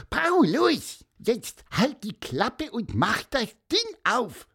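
high, bossy little bird voice; stern squeak. A narrator's reading. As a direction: squeak it sternly, cutting him off. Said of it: "piepste Pieper streng".

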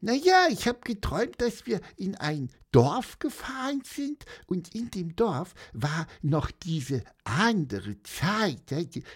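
high, bewildered little bird voice; confused bird voice. Acted. Bird waking confused in hammock, high stammering voice. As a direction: stammer like a just-woken bird. Pieper's voice.

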